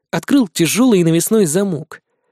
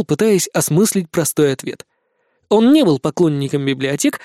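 plain reading voice

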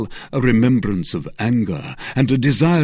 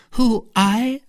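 higher and louder mock indignant cry; 13 semitones higher, 5 dB louder than usual. A narrator's reading. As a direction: cry out as if shocked at the idea. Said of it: "cried Milady".

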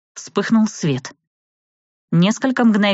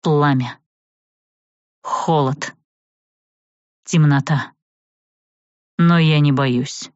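plain reading voice

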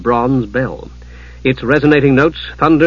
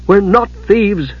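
higher, slow, emphatic denial; growing desperation; 7 semitones higher, slower than usual. A narrator's reading. Palin denying they are thieves, growing desperate. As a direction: protest with rising desperation. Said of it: "Palin was growing desperate".

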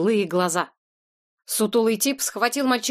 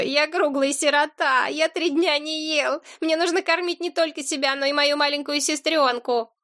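put-on whimpering boy; 6 semitones higher than usual. A narrator's reading. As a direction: whine and beg like a small boy. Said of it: "захныкал мальчишка". (жалобное высокое хныканье)

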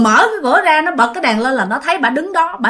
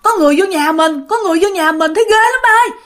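raised urgent voice climbing higher; frightened child's alarm; 6 semitones higher, faster than usual. Retold or imitated. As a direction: plead with dad as a scared child. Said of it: "nó sợ quá các bạn".

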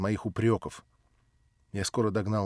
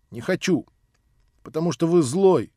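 put-on louder, higher child's angry no; Nelly flings злой at him, loud and high; angry refusal; 7 semitones higher, 7 dB louder, slower than usual. Nelly refuses the old man, loud angry child. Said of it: "прибавила она".

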